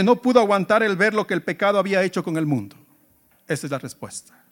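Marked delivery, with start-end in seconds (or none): 3.5-4.4 hushed emphasis. Real, not acted. Preacher stating his key answer.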